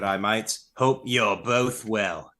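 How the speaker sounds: put-on low-key Australian accent, slowing at the end; careful amateur Australian greeting; Aussie accent. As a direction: try the Aussie vowels, slowly stretched. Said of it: "try to get that Aussie".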